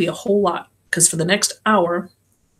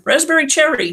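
high call across to the car; 9 semitones higher, 4 dB louder than usual. A narrator's reading. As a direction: call her name out loud. Oddjob calls out to car.

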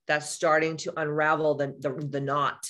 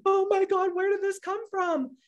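much higher, panicked squeak; high horrified voice, reliving the moment; shocked at herself. Retold or imitated. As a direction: gasp it out in disbelief. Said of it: "'Cause I was like".

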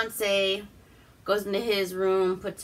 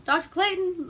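high, questioning call for a missing doctor; mimicked wondering call; 11 semitones higher than usual. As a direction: call out as if searching, pitched up. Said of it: "he's wondering where Doctor Clayton is".

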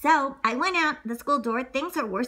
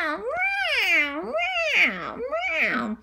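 cat meowing; 8 semitones higher than usual. Acted. Cat chased by the mouse.

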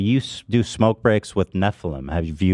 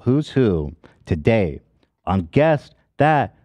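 show host announcer; 10 semitones higher, 3 dB louder, much slower than usual. Acted.

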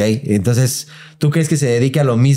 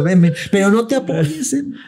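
high, slow grandma voice; old grandma; 10 semitones higher, slower than usual. Retold or imitated. Grandma inviting her grandson down the stairs, high and slow. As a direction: drag it out like the frail grandma. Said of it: "Me lo imagino así como".

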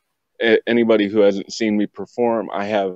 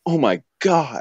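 higher-pitched retold gasp of a reaction; mock amazement; 5 semitones higher than usual. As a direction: imitate an amazed fan's exclamation.

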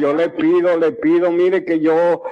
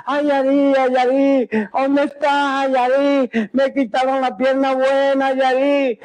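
higher pleading cry for his daughter Yari; retold desperate call; 7 semitones higher than usual. Patient retelling how he called his daughter, higher anguished plea. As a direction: reenact calling out to Yari in anguish, pitched up.

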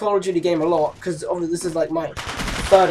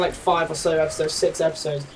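ordinary delivery

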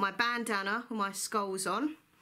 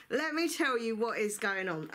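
normal talking voice

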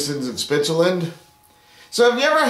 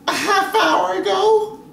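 much higher, slow, yelled; exasperated shout; 4 dB louder than usual. Acted. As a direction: shout it slowly, fed up. Girlfriend yells the bad news.

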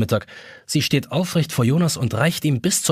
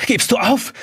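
panting question; 9 semitones higher than usual. A narrator's reading. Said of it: "fragt er keuchend".

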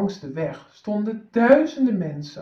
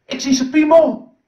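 put-on high, loud child's cry; little boy shouting; 6 semitones higher, 9 dB louder than usual. Little boy shouts at the passing emperor, high and loud. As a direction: blurt it out like a small boy. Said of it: "riep het jongetje".

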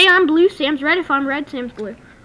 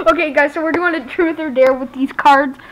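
normal talking voice

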